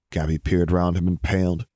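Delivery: ordinary delivery, nothing marked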